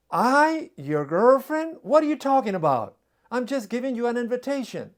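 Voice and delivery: embarrassed woman protesting the announcement, shocked. Retold or imitated. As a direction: sputter it out, caught off guard.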